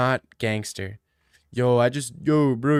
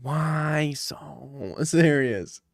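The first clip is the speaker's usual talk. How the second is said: slow Joker line; much slower than usual. Retold or imitated. Telling his friend the news in a slow Joker voice. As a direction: let each word hang like the Joker. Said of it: "and he said".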